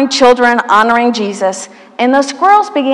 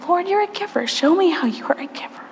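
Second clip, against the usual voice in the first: whispered prayer; 6 semitones higher, 8 dB quieter than usual. Acted.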